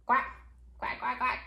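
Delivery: short, low quacks; one quack, then a quick run of three; duck quacks. Acted. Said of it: "Like a duck".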